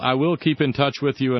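ordinary delivery

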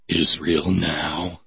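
low, slow, menacing with a whispered finish; ominous slogan, whispered end. Acted. Reading the poster slogan in a low menacing hush. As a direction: let the last words fade to a whisper.

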